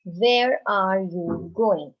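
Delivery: slow, spaced questioning read-aloud; teacher modelling a question; slow model question. Shown as a demonstration. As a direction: ask it slowly for the class to follow. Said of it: "another sentence".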